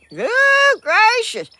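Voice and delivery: high, loud gasp of surprise; surprised exclamation. Real, not acted. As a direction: exclaim it, taken aback. Reacting to compliment.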